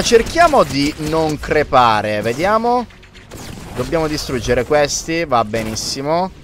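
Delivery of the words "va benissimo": usual speaking voice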